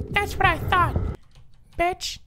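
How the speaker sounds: smug falsetto character voice; very high, squeaky retort; Jimmy. Acted. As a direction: put on a tiny high voice, haughtily. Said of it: "My name is Jimmy".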